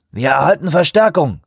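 loud, high called announcement; calling out. A narrator's reading. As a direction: call it out over the engine. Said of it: "rief er".

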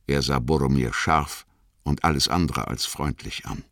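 usual speaking voice

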